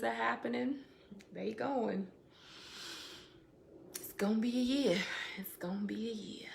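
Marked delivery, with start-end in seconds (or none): 5.4-6.5 whispered aside. Real, not acted. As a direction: whisper "It's gonna be a year." to yourself. Repeats the line, second one whispered.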